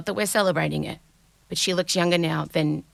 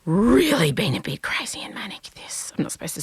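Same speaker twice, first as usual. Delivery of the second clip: whispering. Real, not acted. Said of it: "I feel like I have".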